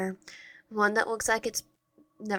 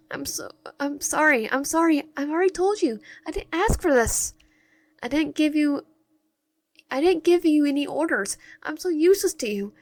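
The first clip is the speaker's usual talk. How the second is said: high-pitched, acted distressed pleading; breaking down; 7 semitones higher than usual. Useless captive begging forgiveness as they break down, acted distress. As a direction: apologize over and over in a much higher voice, mildly hammed-up despair. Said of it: "you, you break down".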